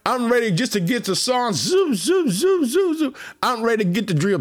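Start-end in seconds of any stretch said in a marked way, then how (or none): 1.5-3.2 zooming sound. Pretend. Imitating speed, mock sound effect.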